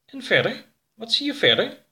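small alien asks his spaceship what else it sees; little alien Oroka. Acted. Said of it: "vroeg Oroka".

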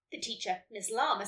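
ordinary delivery, nothing marked

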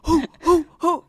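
loud, high team hoots; hype hooting. Retold or imitated. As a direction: hoot like pumped-up cops. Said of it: "they're all like".

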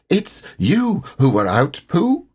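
high small Piglet voice. Acted. Piglet correcting Pooh, high and small. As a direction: squeak it out like a tiny piglet.

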